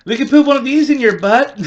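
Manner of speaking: high, loud, laughing tease; laughing through a cheeky dare; teasing laugh. Retold or imitated. Girlfriend teasing him with a Twizzler, high and laughing. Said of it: "She goes".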